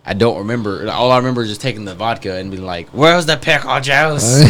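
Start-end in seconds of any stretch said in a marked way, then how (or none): 2.9-4.4 put-on slow, drawn-out drunken demand; higher, sloppy drunk voice; drunk slurring. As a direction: play yourself drunk, dragging out the words. Drunk self demanding pickle juice.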